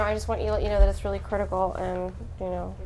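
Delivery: low, hurried, fading mumble; unsure doctor. Shown as a demonstration. Doctor breaking news to a parent, unsure mumbling. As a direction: mumble it unsurely and let it die away. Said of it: "like this".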